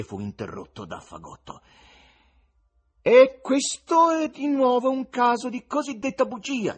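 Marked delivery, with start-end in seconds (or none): 3.0-6.8 high, loud goatish tenor; bleating goat-like tenor. A narrator's reading. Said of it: "con alta voce tenorile da caprone".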